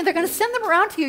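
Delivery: ordinary talk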